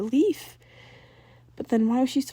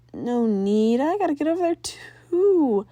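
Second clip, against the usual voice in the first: put-on higher resigned girl voice; Sam voice; 5 semitones higher, 4 dB louder, slower than usual. A narrator's reading. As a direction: sigh it off, resigned.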